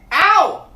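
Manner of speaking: loud high yelp of pain; sharp bear yelp. A narrator's reading. Pooh yelping when Christopher Robin's shot hits him, loud and high. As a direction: yelp as if the shot grazed you.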